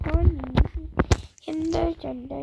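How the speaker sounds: ordinary talk, nothing marked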